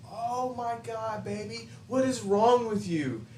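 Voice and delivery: hammed-up sweet talk to a crying girl; smooth player voice. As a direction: coo it like a smooth-talking player.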